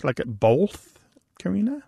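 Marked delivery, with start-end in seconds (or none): 0.3-1.0 "both" with an L slipped in before the th. Shown as a demonstration. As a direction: say "both" as "bolth". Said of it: "with an L sound in it".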